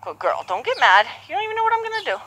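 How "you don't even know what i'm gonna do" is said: high teasing voice. Real, not acted. Teasing the horse, voice jumps up.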